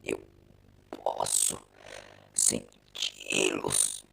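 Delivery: a man losing his mind to the machine; deep, slow, broken cyborg drone; nervous, turning Cyberman. Acted. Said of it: "e nervoso ele disse".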